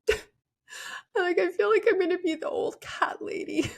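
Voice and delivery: high, laughing, half-tearful; laugh-cry it out up high. Retold or imitated. Telling her friend on the plane she'll be the old cat lady, laughing.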